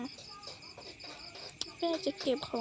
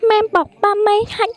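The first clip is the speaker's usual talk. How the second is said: loud, higher pleading wail of mom; child crying out; 6 semitones higher, 12 dB louder than usual. Acted. Child wailing at mother to let them eat. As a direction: beg mom at full volume, near tears.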